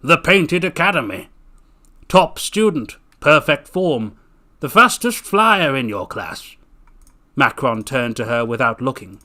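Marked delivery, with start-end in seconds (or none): 0.0-6.6 deep unkind man. Acted. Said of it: "His voice was deep and unkind".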